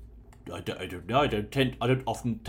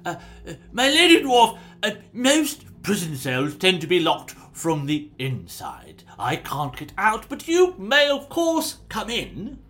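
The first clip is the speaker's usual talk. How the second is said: polite, sing-song nobleman; mock-gracious host; courtly prisoner voice; 7 semitones higher, 7 dB louder than usual. Prisoner voiced by game master.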